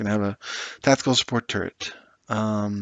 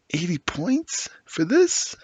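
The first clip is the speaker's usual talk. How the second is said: slow, higher, stunned disbelief; incredulous; 8 semitones higher, slower than usual. Real, not acted. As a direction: drag it out, can't believe the price. Outraged at unit's points cost.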